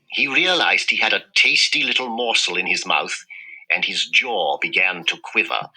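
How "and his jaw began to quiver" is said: usual speaking voice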